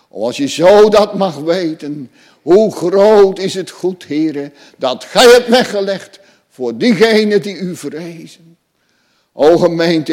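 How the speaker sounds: full-force preaching. Acted. Preacher proclaiming God's covenant to the congregation, fervent and loud.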